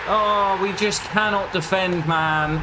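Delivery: high, despairing cry at the defence; real exasperation, voice pitched well up; fed-up wail; exasperated outburst. Real, not acted. Conceding again, exasperated outburst.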